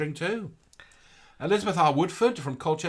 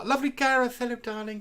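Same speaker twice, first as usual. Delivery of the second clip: sing-song greeting; 11 semitones higher than usual. Acted. High greeting to viewer.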